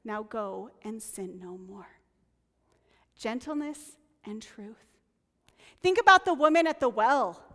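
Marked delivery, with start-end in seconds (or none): 0.0-2.0 a soft, tender quoted line; quiet and gentle, not stern; gently. Retold or imitated. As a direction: say it softly, like a kind release. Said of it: "gently tells her".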